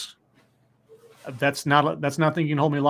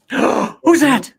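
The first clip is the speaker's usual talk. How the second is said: higher, gasped-in excited question; gasp, then play up the surprise; excited gasping greeting; 9 semitones higher, 4 dB louder than usual. Acted. Host spots a child joining the stream, excited surprise.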